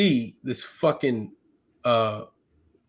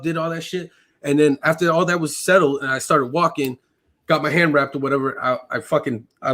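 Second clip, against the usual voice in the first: ordinary talk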